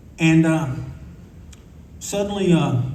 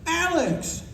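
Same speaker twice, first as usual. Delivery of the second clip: calling out; 4 dB quieter than usual. Retold or imitated. Calling across the pool.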